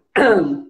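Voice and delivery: demonstrated abrupt throat-clear. Shown as a demonstration. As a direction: clear the throat as a harsh vocal onset. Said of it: "como um pigarro".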